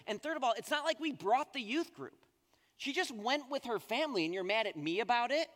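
exasperated retort. Retold or imitated. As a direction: argue back in disbelief. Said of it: "I'm like".

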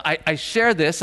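normal talking voice

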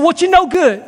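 put-on high-pitched kid's call; imitated cheeky kids. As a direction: pitch it up like kids pestering shoppers. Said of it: "we looked at her and we said".

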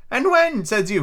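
put-on high, excitable old castaway; squeaky marooned sailor, eager and odd; lightly cracked high pirate voice; Ben Gunn voice. Ben Gunn's voice in the story.

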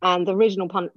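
ordinary talk

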